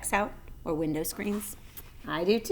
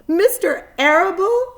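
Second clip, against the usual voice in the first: high, loud distraught cry; a pig sobbing at a betrayal; 12 semitones higher, 11 dB louder than usual. A narrator's reading. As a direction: sob out the name in shock. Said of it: "sobbed Wilbur".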